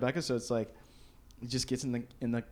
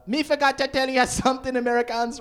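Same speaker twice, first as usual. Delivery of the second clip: higher, louder mock Jamaican announcement; 9 semitones higher, 5 dB louder than usual. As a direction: call out to the crowd in mock patois.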